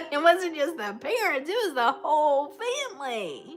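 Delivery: high, loud, laughing exclamation; amazed delight. Real, not acted. Amazed at friend meeting whole family.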